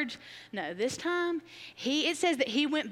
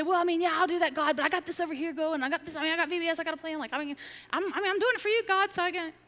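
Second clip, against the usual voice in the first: a higher voice full of excuses; excuse-making self; 6 semitones higher, faster than usual. Pretend. Voicing her own excuses to God.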